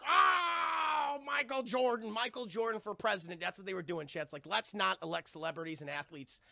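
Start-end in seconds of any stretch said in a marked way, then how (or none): 0.1-3.4 higher, shouted crowd chant; crowd cheering. Retold or imitated. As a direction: cheer him like a stadium crowd. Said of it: "everyone's like".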